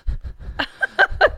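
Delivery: high airy burst of laughter; laughing burst. Real, not acted. Cohost laughs at fast talker.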